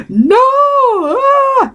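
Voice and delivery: a high, far-off wail on "ah". Retold or imitated. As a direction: let the "No" break into a high cry. Answering pastor, overwhelmed by emotion.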